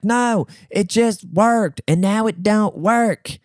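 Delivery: high, slow, dopey drawl; mock a clueless complainer, dragged out high; stupid customer voice. Customer complaining to the store worker about Wi-Fi, stupid mocking voice. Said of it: "like this in his stupid voice".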